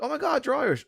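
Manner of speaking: high-pitched excited greeting, retold; retold excitement. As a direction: act out squealing at meeting compatriots. Retold delighted meeting with fellow Irish.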